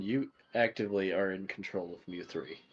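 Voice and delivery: ordinary delivery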